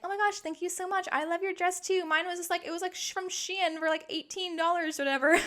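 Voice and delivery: high, loud, gushing rush of excuses; nervous overcompensating. Retold or imitated. Thanking someone for a dress compliment, nervous high gushing. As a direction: babble nervously to cover the compliment. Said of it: "I'll get nervous and I'll say".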